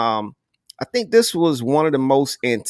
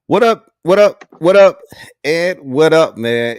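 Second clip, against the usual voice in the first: loud, high-pitched hype shout-out; hype greeting; 4 semitones higher, 7 dB louder than usual. Real, not acted. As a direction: pump up the crowd as the show kicks off. Hype greeting to open show.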